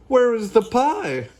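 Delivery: loud, high question; high-pitched hollered demand; boisterous demand. Acted. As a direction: call out eagerly for food. Noisy Bean demands pie.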